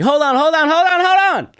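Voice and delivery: high, repeated urgent holler; imitated calling back. Woman as he walks away, high mock urgency. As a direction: stop him leaving in her shrieky voice.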